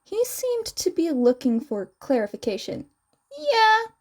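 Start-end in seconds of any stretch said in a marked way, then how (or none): 3.4-3.9 higher, louder yeah; bright yeah. Acted. Mei confirming to Hitoshi, high and loud. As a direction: chirp it.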